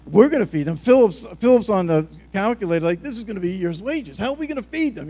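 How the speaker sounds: mock-incredulous outburst, loud start, high finish; freaking out. Disciples told to feed the crowd, freaking out. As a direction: protest in exaggerated disbelief. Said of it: "they're, like, freaking out".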